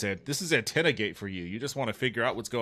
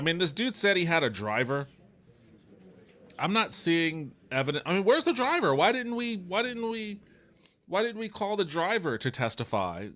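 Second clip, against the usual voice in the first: exasperated; 7 semitones higher than usual. Real, not acted. Judge fumes about missing driver.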